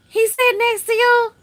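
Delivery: high, loud gasping question, caught out; shocked gasp. Retold or imitated. Daughter realizing her father heard everything, high shocked gasp. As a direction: gasp and shoot the voice way up in alarm.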